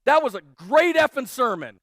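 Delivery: blurting out happy praise; excited visitor. Retold or imitated. Newcomer gushing to the pastor after church, loud and excited. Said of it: "He said".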